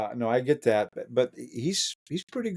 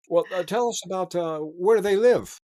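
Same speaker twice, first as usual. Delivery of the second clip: curious group voice; 8 semitones higher than usual. Retold or imitated. Retold group questioning.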